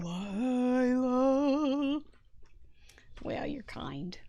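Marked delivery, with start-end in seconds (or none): 0.0-2.0 slow, drawn-out late-night DJ; Delilah radio voice. Pretend. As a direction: stretch the name like a radio host. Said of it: "if I talk like her".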